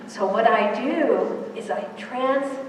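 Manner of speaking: usual speaking voice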